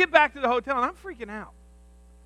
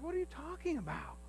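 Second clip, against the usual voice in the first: higher, much softer mother voice; calm mom; 6 semitones higher, 17 dB quieter than usual. Retold or imitated. Mother answering her son's panic, much softer. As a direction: brush it off. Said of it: "She's like".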